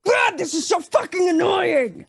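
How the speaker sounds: high, frustrated shout; acted annoyed yell; exasperated rage. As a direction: cry out, fed up with the racket. Character rages at the noise.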